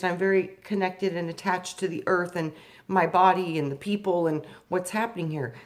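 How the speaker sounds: excited pleading. Retold or imitated. Excited retold plea to God.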